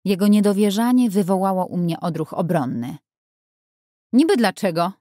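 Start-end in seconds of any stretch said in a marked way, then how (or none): 4.1-4.9 defensive, a bit louder retort; woman defending herself against his doubt; sharper tone. A narrator's reading. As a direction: bristle back at him. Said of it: "Mój nieco ostrzejszy ton".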